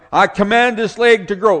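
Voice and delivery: high, loud healer's command; hollering command. Retold or imitated. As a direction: order the leg to grow at full volume. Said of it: "he's hollering".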